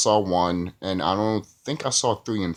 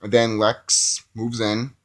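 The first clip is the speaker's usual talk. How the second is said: ordinary talk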